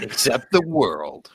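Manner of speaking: slow dramatic trailer line; horror trailer voice. Acted. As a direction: say it ominously and slow. Joins trailer parody.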